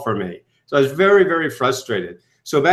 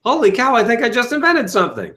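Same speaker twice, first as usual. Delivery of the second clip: excited discovery; 7 semitones higher than usual. Retold or imitated. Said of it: "I said".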